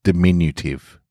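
plain reading voice

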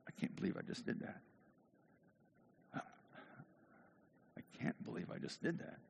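whispered disbelief, very soft; hushed, acted self-reproach; stunned remorse. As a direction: whisper it to yourself in shame. Acts Peter's remorse after rooster.

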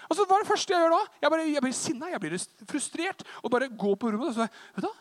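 ordinary talk

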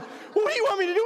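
high, fast, helpless plea; hammed-up panicked parent; frantic dad. Dad to crying daughter, mock frantic.

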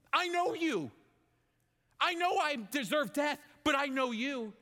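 pleading prayer. Acted. David crying out to God in repentance, pleading. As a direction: cry out to God, high and urgent.

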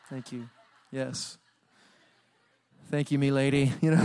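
normal talking voice

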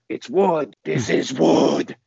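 drawn-out imitation of a friend yelling; half-strength retold yell, slow and insistent; mock yelling. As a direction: stretch out the final word in a mock yell. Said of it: "Joe just keeps yelling".

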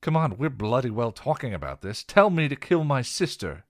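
loud, high enraged challenge; Buffy confronting Giles, enraged and loud; defiant and enraged. Acted. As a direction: dare him to say it. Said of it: "defiant and enraged".

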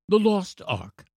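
put-on high, eager child; demanding boy. A narrator's reading. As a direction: demand it as an excited little boy. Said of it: "demanded the boy".

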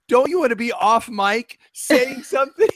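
laughing teasing quote. Retold or imitated. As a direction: tease through a laugh. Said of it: "you said to him".

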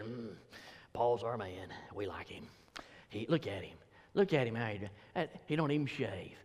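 low, soft mock congregant gushing over Paul; lightly voiced fan of Paul, cooing his praise; church member voice. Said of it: "some Gentiles in the congregation that said".

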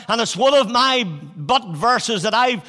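normal talking voice

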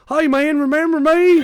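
high, loud imitation of an eager tourist; excited called greeting. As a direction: holler it like a long-lost buddy.

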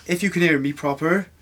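a proper Bedminster West Country voice; West Country accent. Acted. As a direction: call out to the spirits in a broad Bristol accent. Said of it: "Try and talk in a West Country accent".

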